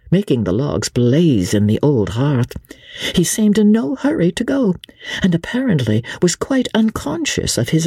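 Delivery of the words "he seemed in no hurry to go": plain reading voice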